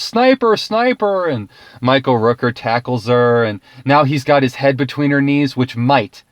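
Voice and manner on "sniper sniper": high, sharp warning yell of a bodyguard; a retold bodyguard's raised shout; mock yell. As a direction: call out the sniper alarm in alarm. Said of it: "her Secret Service detail yells".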